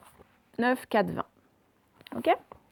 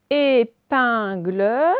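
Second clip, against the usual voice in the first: "Épingle" very slow and loud, syllable by syllable; loud, slow "Épingle" with rising end; syllable demonstration; 5 dB louder, much slower than usual. Shown as a demonstration. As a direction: stretch "Épingle" so each syllable is heard. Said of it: "qui est en deuxième position".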